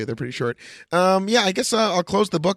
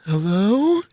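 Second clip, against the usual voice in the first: louder eerie call into the drain; pretend Pennywise call; 4 semitones higher, 5 dB louder than usual. Pennywise calling up from the sewer, louder and eerie. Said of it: "wait, what do I say?".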